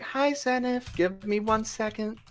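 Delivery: high, soft cheerful greeting; light high woman. Acted. Tabaxi woman greeting Zenith mid-fight, high and soft.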